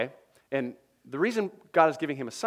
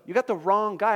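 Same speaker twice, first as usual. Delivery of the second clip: higher and louder, pleading; panicked protest; 7 semitones higher, 4 dB louder than usual. Acted. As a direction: insist they've picked the wrong man. Moses protests, repeated plainly later.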